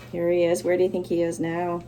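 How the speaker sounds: ordinary delivery, nothing marked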